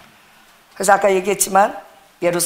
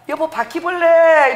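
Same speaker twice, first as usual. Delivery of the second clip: whiny call; 9 semitones higher, 4 dB louder, slower than usual. Retold or imitated. Wife calling husband about cockroach.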